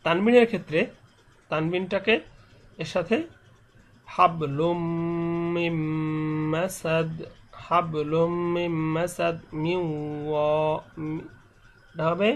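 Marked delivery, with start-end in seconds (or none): 4.1-10.8 "হাবলুম মিম মাসাদ" slow and level, m's held as hums; ghunnah recitation. Shown as a demonstration. Teacher demonstrating pronunciation only, slow humming chant. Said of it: "শুধু উচ্চারণটা আমি বলছি".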